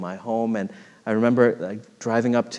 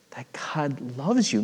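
hushed whisper; 2 semitones higher, 5 dB quieter than usual. Acted. Preacher to congregation, a sudden whisper. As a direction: lean in and whisper it.

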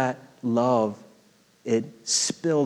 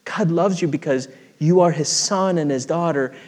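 usual speaking voice

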